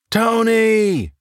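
high, desperate yell of a name; shouting for a lost friend. Acted. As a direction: call out the name in panic. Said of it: "Tracy yelled".

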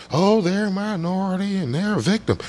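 mocking parroting. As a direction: parrot a tired talking point in a high voice. Mimicking how politicians talk about victims.

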